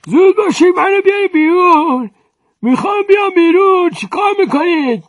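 put-on high, loud squeal of a trapped old man; trapped old man squirming. Stuck mender demands to be freed, high frantic squeal. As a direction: writhe and demand to be let out. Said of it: "مثل مار زخمی با خودش میپیچه".

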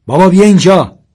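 put-on high loud child's call; shouting. A boy hiding behind a tree calls his father, shouting. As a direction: yell across the woods for dad. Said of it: "سیاوش داد زد".

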